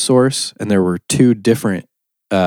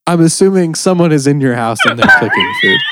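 raised laughing voice over the beeping; shouting over fire alarm; 10 semitones higher, 5 dB louder than usual. Real, not acted.

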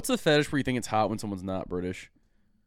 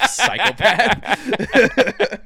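laughing; 8 semitones higher, 8 dB louder, much slower than usual. Real, not acted. Laughing insult at friend.

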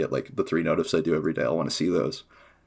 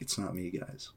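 quiet aside; 15 dB quieter than usual. Retold or imitated. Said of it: "And I'm like".

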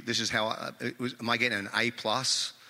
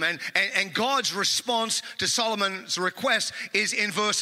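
louder, higher, driving pulpit delivery; preaching; 6 semitones higher, 4 dB louder than usual. Acted. Pastor preaching to the congregation, full-force sermon delivery.

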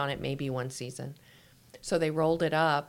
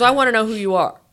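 angry demand; 5 semitones higher, 14 dB louder, faster than usual. Retold or imitated. Angry caller on phone.

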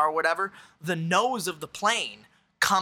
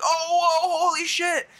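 high, loud mock-shocked outburst; shocked reaction; 14 semitones higher, 5 dB louder than usual. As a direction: act out stunned onlookers crying out. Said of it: "And it's like".

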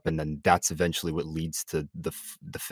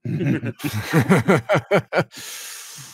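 sudden burst of loud laughter; 10 semitones higher, 7 dB louder than usual. Real, not acted. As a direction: crack up out loud. Laughs at crude joke.

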